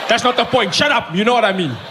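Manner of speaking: put-on irritated Afrikaans accent; angry Afrikaans fan. Rugby fan silencing the comedian, angry Afrikaans accent. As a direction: cut him off in an Afrikaans accent. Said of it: "Afrikaans people get angry".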